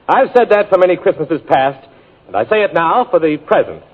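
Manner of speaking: usual speaking voice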